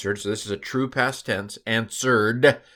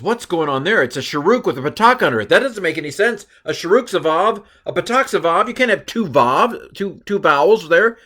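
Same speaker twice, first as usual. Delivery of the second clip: mock confusion, raised higher and louder; puzzled thinking aloud; 7 semitones higher, 6 dB louder than usual. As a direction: replay your baffled first reaction. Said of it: "My first thought was".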